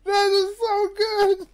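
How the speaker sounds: high-pitched squeal of delight; thrilled, laughed-out high voice. Real, not acted. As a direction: gush it in a high voice. Delight after long laughing fit.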